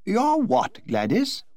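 startled Duchess exclaims at Gladys's strange word. Acted. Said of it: "exclaimed the Duchess".

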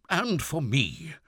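loud, high, slow outburst; harsh, shaken voice. A narrator's reading. Father breaking in on his own plea, harsh and shaken. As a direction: voice wrought up, as if something snapped. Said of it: "His harsh voice shook".

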